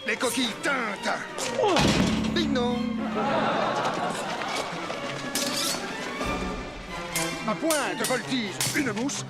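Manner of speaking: ringing verse over the clash of swords. Acted. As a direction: proclaim each line with bravado. Declaims ballade while sword fighting.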